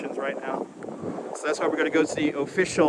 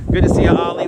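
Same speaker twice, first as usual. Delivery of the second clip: shouting over wind; 8 dB louder, faster than usual. Real, not acted. Sorry about the wind noise.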